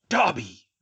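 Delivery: loud, falling rebuke; hissed anger. A narrator's reading. Malfoy to his former elf, loud angry exclamation falling at the end. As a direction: spit the name out hard, pitch dropping. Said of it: "Mister Malfoy hissed".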